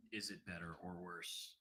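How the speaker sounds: very soft, fading away; quiet mic test. Shown as a demonstration. Streamer testing his mic while speaking very softly. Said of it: "when I talk quietly".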